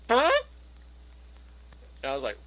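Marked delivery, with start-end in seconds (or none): 0.1-0.3 short burst of mock flatulence; comic ripping-one sound effect; imitated dog fart. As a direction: blurt out a sudden fart noise. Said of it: "all of a sudden just goes".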